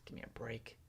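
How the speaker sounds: whispered, dismissive scoff. Real, not acted. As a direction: mutter it in disgust. Scoffing at market price.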